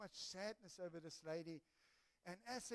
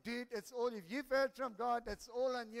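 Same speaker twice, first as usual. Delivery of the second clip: louder, bursting-out reply; retold outburst; 3 semitones higher, 8 dB louder than usual. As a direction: burst in emphatically, passing it back. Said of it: "so I'm bursting out".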